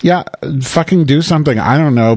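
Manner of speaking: ordinary delivery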